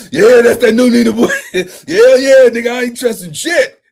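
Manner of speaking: laughing. Real, not acted. Laughing loudly.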